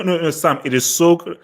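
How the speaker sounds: ordinary talk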